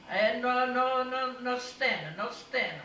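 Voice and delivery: high, loud flustered refusal; flustered Italian mama saying no, loud; angry mother. Retold or imitated. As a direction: refuse it loudly, getting cross.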